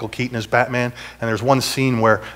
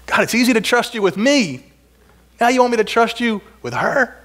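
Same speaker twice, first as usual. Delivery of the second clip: anguished protest; 8 semitones higher than usual. Retold or imitated. Arguing with God over daughter.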